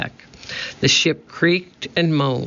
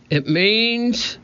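pirate captain; 8 semitones higher than usual. A narrator's reading. Captain unsure.

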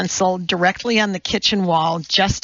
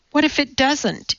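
higher-pitched, anxious plea; desperate; 7 semitones higher than usual. A narrator's reading. Young mother doubting it will get better, desperate. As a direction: ask it with rising desperation. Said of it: "Elizabeth said desperately".

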